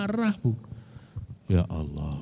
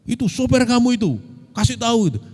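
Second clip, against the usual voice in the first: angry driver; 11 semitones higher, faster than usual. Retold or imitated. Angry driver scolding the speaker.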